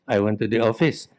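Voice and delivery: ordinary talk, nothing marked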